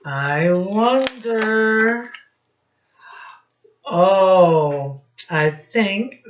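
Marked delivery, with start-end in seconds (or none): 0.1-5.0 slow, louder musing with a higher pitch; teacher teasing the class with suspense; wondering aloud. Acted. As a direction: draw it out to build suspense.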